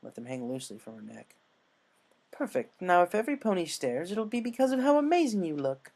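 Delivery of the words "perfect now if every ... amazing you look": high, loud, beaming friend; excited cheerful voice. Acted. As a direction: cheer her on, high and bright. Applejack praises Rainbow.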